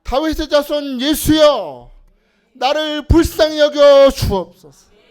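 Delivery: very loud, slow desperate cry. Shown as a demonstration. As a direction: shout the plea with everything, slowly. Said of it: "우리도 부르짖어야죠". (온 힘 다해 부르짖는 기도)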